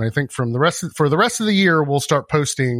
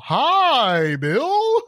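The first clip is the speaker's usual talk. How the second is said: much higher, sing-song fake-friendly hello; fake cheerful greeting; 12 semitones higher than usual. Host faking a cheerful hello to his neighbor, much higher. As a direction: put on a forced happy face for the neighbor.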